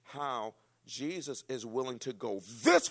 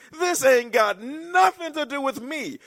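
much higher, loud, slow and stretched; loud bad English; 15 dB louder, slower than usual. Preacher acting Jesus brushing off Mary, high loud drawn-out. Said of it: "that's how I would say, I know it's bad English".

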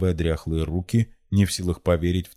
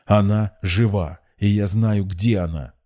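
raised, emphatic outburst; blurted out; 4 semitones higher, 5 dB louder, slower than usual. A narrator's reading. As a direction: blurt it straight in the listener's face. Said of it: "выпалил ей в лицо Соколов".

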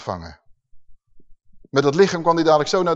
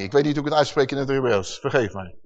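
ordinary talk

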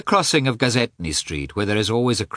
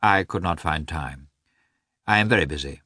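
dry answer; 4 semitones lower than usual. Acted. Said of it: "Karenin answered dryly".

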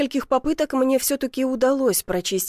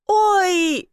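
startled yell, higher and louder; 9 semitones higher, 5 dB louder than usual. A narrator's reading. Man hit by soot from the chimney, startled yell. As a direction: yelp it out in alarm. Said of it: "заорал".

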